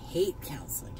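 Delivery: a hushed, breathy admission to viewers; whispered aside. Real, not acted. Confiding to her viewers about counseling, whispered. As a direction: whisper it like a secret you're ashamed of. Whispered confession in emotional vlog.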